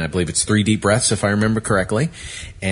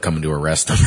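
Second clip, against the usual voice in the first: ordinary talk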